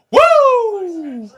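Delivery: high, drawn-out "woo"; sustained celebratory whoop; excited whoop. Real, not acted. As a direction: let out a long, high cheer. Cheering after North Carolina.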